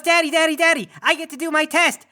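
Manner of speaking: put-on high, excited little boy calling his dad; eager kid voice. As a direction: bounce it out like a kid who can't wait. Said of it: "he goes".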